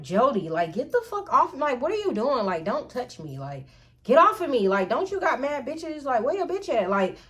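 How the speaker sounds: retold outburst, high and agitated; indignant, rising disgusted protest. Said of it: "I was just so disgusted".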